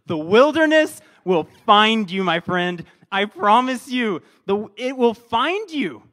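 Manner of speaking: loud, emphatic promise; intense. Shown as a demonstration. Pastor promises the congregation intensely, loud and emphatic. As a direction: drive it home like a warning. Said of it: "I like the intense version".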